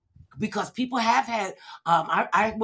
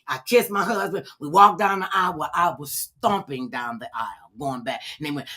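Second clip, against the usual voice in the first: usual speaking voice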